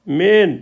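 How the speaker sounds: "मेन" with a long held ए; teacher showing the long ए in "मेन"; lengthened ए. Shown as a demonstration. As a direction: model "मेन" drawn out, falling at the end. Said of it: "लंबा है इसका उच्चारण".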